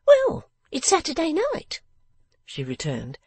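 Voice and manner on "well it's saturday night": high-pitched character voice, briskly dismissing a visitor; sharp little Miss Wren. Acted. Figure of Miss Wren in narration.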